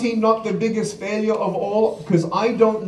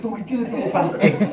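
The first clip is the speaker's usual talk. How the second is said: imitated flustered parent; faster than usual. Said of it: "The parents are freaking out".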